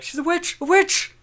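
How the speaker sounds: very high, rising shrill accusation; Monty Python villager. Retold or imitated. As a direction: point and cry out like a villager. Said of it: "He's like".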